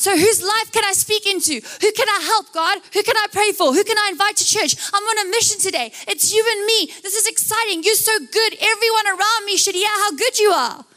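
high, eager rush of questions and praise; thrilled to be on a mission; excited. Acted.

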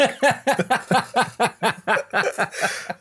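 sputtering laugh through a mouthful; laughing hard around a sip of drink; laughing with mouth full. Real, not acted. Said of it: "It almost went out my nose".

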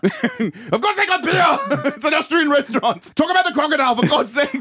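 high, laughing, losing the character; can barely get the words out for laughing; breaking up laughing. Acted. Arnie as waiter cracks up.

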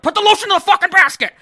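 imitated furious scream, high and fast; enraged scream. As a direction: scream it like an exploding killer, very loud. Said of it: "gets pissed off and screams".

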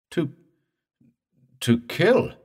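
halting, high, rising disbelief; choked question. A narrator's reading. Morse learning the dead man's purpose, choked question. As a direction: stammer it out, choked with shock. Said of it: "Morse choked".

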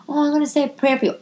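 high, fast sing-song of fake piety; hammed-up pious sweetness. Mocking a churchy acquaintance, sugary fake piety. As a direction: gush the prayer offer in mock sweetness.

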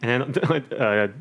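usual speaking voice

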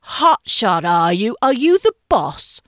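loud, taunting English chef; hammed-up sneering British boss; Gordon Ramsay impression. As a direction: challenge the cook in a bad English accent. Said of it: "do his Gordon Ramsay impression".

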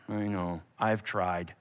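very low, soft, defeated; weary confession. Pretend. Chris admitting to God he failed, soft and low. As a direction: sigh it out, beaten.

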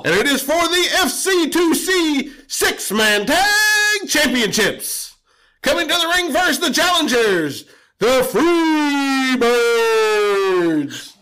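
booming ring announcer voice. Pretend. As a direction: announce it to the arena.

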